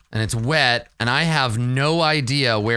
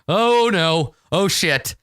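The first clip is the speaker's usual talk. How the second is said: acted alarm; 5 semitones higher, 4 dB louder, slower than usual. Acts character's reaction to rumbling mountain.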